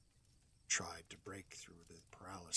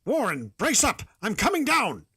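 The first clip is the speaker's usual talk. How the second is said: much higher, much louder call down into the pit; determined shout; 17 dB louder than usual. Acted. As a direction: shout firm resolve to a friend below. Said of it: "I formed and shouted a resolution".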